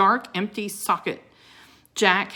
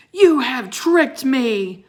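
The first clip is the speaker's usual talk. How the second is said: slow, loud and high, spitting out each word; furious accusation; 6 semitones higher, 8 dB louder, slower than usual. Acted. Wizard accusing Jack and Annie, furious and slow. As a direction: drag out the accusation in rage.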